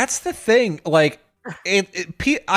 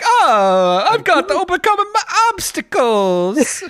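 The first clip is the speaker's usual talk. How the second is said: high, hammed-up cartoon hero; Mario; 11 semitones higher than usual. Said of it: "while Mario is like".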